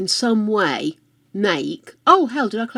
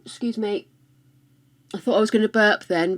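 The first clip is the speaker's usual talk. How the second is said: usual speaking voice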